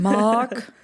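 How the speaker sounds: put-on Salt's Neck accent, R dropped in "Mark"; Cobell. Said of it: "I love the way she says Mark".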